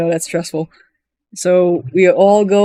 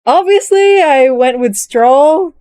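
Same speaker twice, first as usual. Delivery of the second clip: much higher, excited "Obviously"; gushing; 7 semitones higher, 3 dB louder, slower than usual. Real, not acted. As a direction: burst out with fan delight. Gushing about favourite character.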